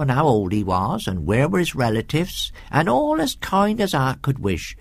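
rustic servant woman, gossiping; comfortable housekeeper's dialect voice; chatty country housekeeper. Acted. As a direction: lilt through it like a homely storyteller.

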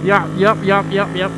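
loud excited yeps while riding; loud calling out. Real, not acted. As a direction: call out cheerfully over the engine.

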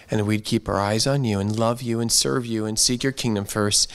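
ordinary talk

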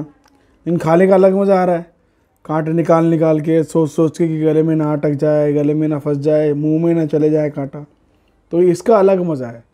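ordinary talk, nothing marked

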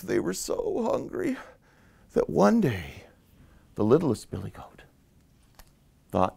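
ordinary delivery, nothing marked

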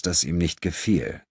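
ordinary delivery

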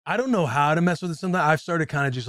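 ordinary talk

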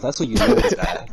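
laughing through pain. Real, not acted. Laughing at own bad situation.

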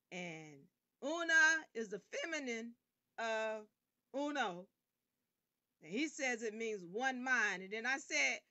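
ordinary talk, nothing marked

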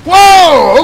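high, loud startled whoa; surprised whoa. Real, not acted. Gamer startled by a crash, high loud whoa. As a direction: yelp as the car gets hit.

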